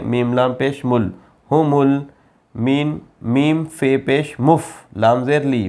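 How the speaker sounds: normal talking voice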